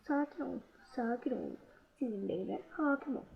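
self-soothing. Real, not acted. Player telling themself to keep their temper, quiet self-soothing. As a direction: talk yourself down softly. Calming self during the game.

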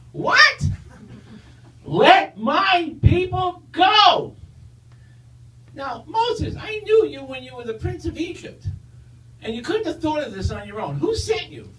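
high squeaky Stooges voice; Curly voice. Acted. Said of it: "high-pitched voice that sounds a little bit like Curly from the Three Stooges".

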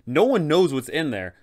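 ordinary delivery

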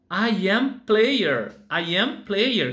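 drawn out for repeating; very slow, word by word. Shown as a demonstration. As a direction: say it slowly and clearly for kids. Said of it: "Vamos falar o nome do título".

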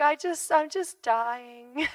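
stretched slowly; slow deadpan dying; slow drawn-out. Retold or imitated. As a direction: draw it out slowly. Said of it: "I'm like".

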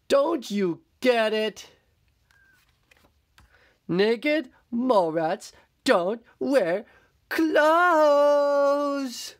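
rising to a loud high wail; mad. Acted. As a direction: scold him, building to a cry. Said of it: "They're so mad".

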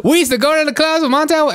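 very high, put-on mimicking voice; mocking imitation. Host mocking the candidate's club story, high-pitched imitation. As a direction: parrot her in a silly high pitch.

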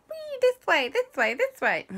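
high squeaky bee guide calling; little bees beckoning, high and sing-song. Acted. Bees guide the children out, high chirpy calling.

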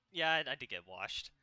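ordinary delivery

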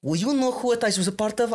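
retold challenge in an Armenian accent; imitated Armenian man. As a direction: mimic an Armenian man sizing someone up. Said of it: "this Armenian guy who thought".

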